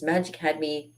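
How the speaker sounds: ordinary talk, nothing marked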